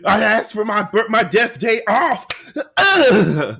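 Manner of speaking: high, loud groaning complaint; hammed-up griping between big groans; exasperated office worker. Co-host playing afterlife Office Space worker denied leave, exasperated. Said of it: "trying to get a remake of Office Space".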